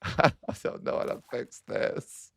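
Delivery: breathy near-whisper, slow and defeated; half-laugh it out, barely voiced; helpless laugh. Real, not acted. Streamer giving up on fixing her nation, laughing under her breath.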